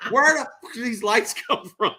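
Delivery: high-pitched mock outrage; irate builder, imitated. Retelling the builder's fury at the final walkthrough, high irate mimicry. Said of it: "the builder was irate".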